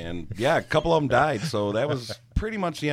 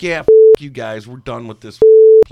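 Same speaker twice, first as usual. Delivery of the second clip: much louder, a bit higher, furious telling-off; angry shout; 4 semitones higher, 17 dB louder than usual. Retold or imitated. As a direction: yell them out of the bar. Managers yelling at bad tippers.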